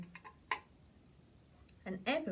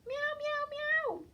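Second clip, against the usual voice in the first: very high, playful meowing; squeaky pretend cat; slower than usual. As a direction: mew like a kitten to fool the robbers. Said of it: "pretend to be a cat".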